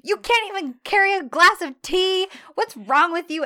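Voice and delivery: high, loud staged scolding; over-the-top shrieked reprimand; mock yelling. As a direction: yell it like a hammed-up public telling-off. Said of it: "that's when she starts yelling at him".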